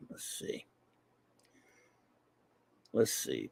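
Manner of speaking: muttered whisper while searching. Real, not acted. Scanning the chat.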